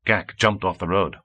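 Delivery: usual speaking voice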